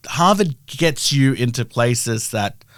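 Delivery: usual speaking voice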